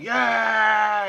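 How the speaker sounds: loud, high cheer of agreement; crowd shout. Acted. Crowd cheering the speaker on, shouting. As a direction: yell it like a roused mob. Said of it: "people sort of shouting".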